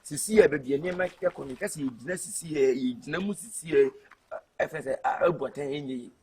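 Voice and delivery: low, soft explanation; subdued explaining. Acted. Settling a family dispute in talk.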